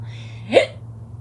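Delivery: sharp hiccup gasp; sudden hiccup catch of breath. Real, not acted. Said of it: "Sorry, I have the hiccups".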